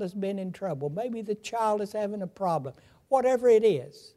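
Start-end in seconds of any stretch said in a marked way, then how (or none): none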